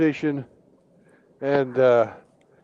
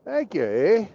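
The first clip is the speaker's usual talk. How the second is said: Canadian ice fishers loving the heat, higher and louder; cheerful Canadians in hell; 7 semitones higher, 3 dB louder than usual. Acted. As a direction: play a happy hoser thanking the devil. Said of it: "they're like".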